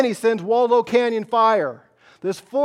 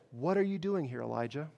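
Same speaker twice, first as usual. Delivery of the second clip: soft divine question; 4 semitones lower, 12 dB quieter than usual. Retold or imitated. God's quiet voice in cave.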